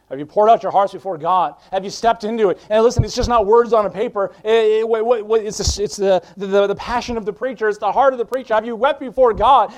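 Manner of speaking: preaching at full force. Acted. Preacher pressing the congregation to weep before God, loud and fervent. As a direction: drive the challenge home like a revival preacher. Climax of the sermon.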